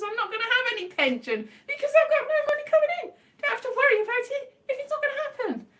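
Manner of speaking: high, fast laughing rant. Real, not acted. To viewers about having no pension, laughing. Laughing at own no-income situation.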